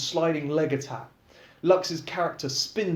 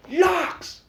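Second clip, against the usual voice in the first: calling out; 5 dB louder than usual. A narrator's reading. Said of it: "he calls out".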